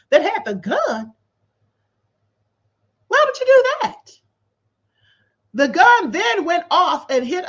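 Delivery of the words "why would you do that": high, loud disbelieving outburst; incredulous. Real, not acted. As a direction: blurt it out in total disbelief. Incredulous outburst at lawsuit text.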